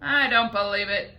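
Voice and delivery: loud stubborn shout. A narrator's reading. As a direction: centipede shouts his disbelief. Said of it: "shouted the centipede".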